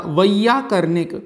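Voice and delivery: वैयाकरणिक, slow, each syllable clear, starting with "vai"; correct pronunciation. Shown as a demonstration. Teacher showing the class the correct word, slowly. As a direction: draw out वैयाकरणिक carefully, beginning with "vai". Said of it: "तो शब्द क्या बन जाएगा".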